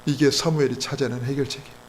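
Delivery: ordinary talk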